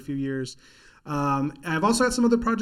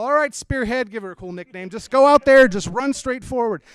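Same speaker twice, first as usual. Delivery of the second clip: louder, higher coach pep talk; football coach; 6 semitones higher, 9 dB louder than usual. Retold or imitated. Dad coaching his cone-headed daughter, loud coach. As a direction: shout it like a sideline coach.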